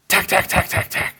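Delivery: clicky imitated tack tack of a wrench; mock wrench tapping. Mechanic under the car, imitated wrench taps. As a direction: tap out the wrench sounds with your voice.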